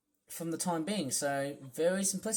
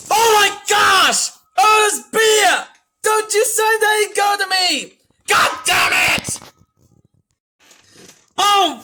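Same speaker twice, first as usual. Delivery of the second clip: real angry shouting, much higher and louder; furious yelling; 12 semitones higher, 13 dB louder than usual. Real, not acted. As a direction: blow up at the screen in a rage. Yelling at the game character.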